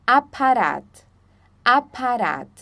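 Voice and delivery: Apparat said with open German a, a-pa-RAT; German pronunciation. Shown as a demonstration. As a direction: model Apparat slowly, open a sounds. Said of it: "Por exemplo".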